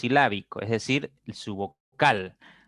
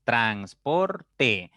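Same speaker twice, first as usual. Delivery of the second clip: split into syllables. Shown as a demonstration. Teacher corrects to three syllables, "transporte" sounded out syllable by syllable. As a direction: say "transporte" slowly, one syllable at a time. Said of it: "tres sílabas".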